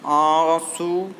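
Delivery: आंसू slow with nasal आ; nasal vowel demo. Shown as a demonstration. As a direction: draw out आंसू through the nose. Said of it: "अनुनासिक में". (आंसू को धीरे अनुनासिक बोलना)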